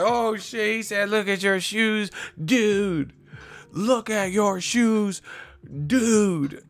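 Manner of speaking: high, hyped-up dumb listener voice; high mock awe over a cheap line; mock excited fan. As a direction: gush like an easily impressed fan. Mocking excited shallow listener.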